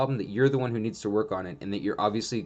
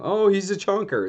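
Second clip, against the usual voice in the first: doting owner; 10 semitones higher, 4 dB louder than usual. Retold or imitated. Owner cooing over fat puppy.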